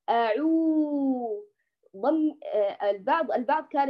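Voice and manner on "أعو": أعو drawn out very slowly with lips fully rounded; full lip rounding. Shown as a demonstration. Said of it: "ضمي ضما كاملا".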